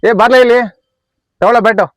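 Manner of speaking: loud, high summoning shout; calling over. Acted. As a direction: holler for a teammate to come over. Summons a player to take bat.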